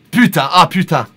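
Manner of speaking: lightly voiced Parisian cursing; a hint of a Paris accent; France French swearing. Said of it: "That's more French France".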